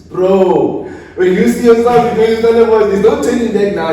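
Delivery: loud, high-pitched storytelling burst; excited exclamation. Real, not acted. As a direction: shout it out, hyped about the ordeal. Emphatic retelling of gruelling run.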